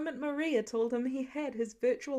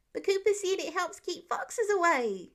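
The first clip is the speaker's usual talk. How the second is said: put-on protesting child; 6 semitones higher, 4 dB louder than usual.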